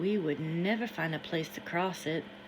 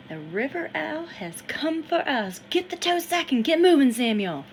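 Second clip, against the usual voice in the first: put-on higher, louder voice of an excited boy in the river; excited Harrison; 7 semitones higher, 7 dB louder than usual. Harrison urges Samuel into the river, higher and louder. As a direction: urge him in, wild and eager.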